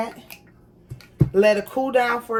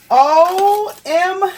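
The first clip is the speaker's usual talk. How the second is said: excited squeal; 8 semitones higher, 6 dB louder, much slower than usual. Real, not acted. Sees the perfect print.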